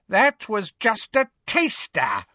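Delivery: high-pitched villainess taunt; Bellatrix. A narrator's reading. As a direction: threaten with relish in a high voice. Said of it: "said Bellatrix".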